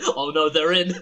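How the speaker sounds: loud, laughing mock panic; laughing alarm. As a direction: cry it out through laughter. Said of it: "I was like".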